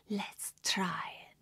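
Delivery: put-on slow whispered TikTok girl; imitating her whisper. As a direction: breathe it slowly like the clip. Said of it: "puts a straw in and says".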